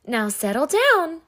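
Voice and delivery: gently coaxing the class to settle; light, sweet and patient; sweet teacher voice. Acted. Sweet Miss Nelson calming her rowdy class. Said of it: "said Miss Nelson in a sweet voice".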